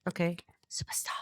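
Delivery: breathy teasing whisper. Acted. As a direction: whisper it like a secret. Teasing the superstar card.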